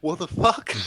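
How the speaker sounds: shouted, high "What the fuck?"; बाहर से आए व्यक्ति की हैरानी भरी चीख; baffled outburst. Retold or imitated. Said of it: "then you go".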